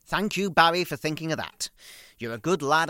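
loud, high sarcastic thanks; loud sarcastic praise; mock thanks. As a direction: mimic the thanks she never said. Voicing thanks mother never gave.

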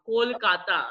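Kolkata with the O leaned on, rounded; accentuated O. Shown as a demonstration. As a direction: coach it: "Kolkata" with a full Bengali O. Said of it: "accentuate your O's".

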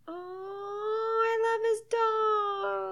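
high, slow and soft cooing. Real, not acted. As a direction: coo over a cute dog. Cooing at a movie dog.

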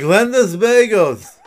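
slow, high, loud announcer; hammy bagel ad voice. Retold or imitated.

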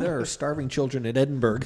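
loud mock scolding; mock outrage. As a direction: scold them, half joking. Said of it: "I'd be like".

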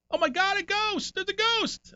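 imitating a roommate screaming about a ghost in the night; frightened scream. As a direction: shriek it like a spooked old man. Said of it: "he starts screaming".

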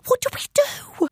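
very high, panicked plea; panicking. Acted. Child trapped with Mum as footsteps come, panicking. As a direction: plead in a high, frightened voice. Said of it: "I was panicking now".

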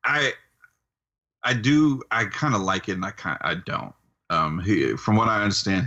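usual speaking voice

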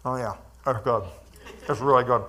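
talking through a mouthful; mouth full. Real, not acted. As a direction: say it round a bite of food. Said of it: "talking with my mouth full".

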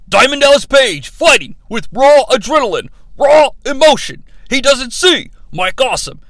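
overwrought commentator monologue, punched phrase by phrase; Scott Hudson. Retold or imitated. Recapper quoting the announcer's storyline speech, overwrought delivery. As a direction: deliver it as an Emmy-bait speech. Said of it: "Scott Hudson tries to win himself an Emmy".

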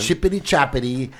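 a light mock British accent. Comedian playing up a fake British accent for listeners. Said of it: "an English accent".

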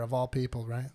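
ordinary talk, nothing marked

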